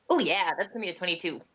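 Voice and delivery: ordinary talk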